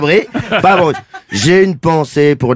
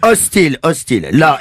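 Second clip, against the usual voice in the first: ordinary talk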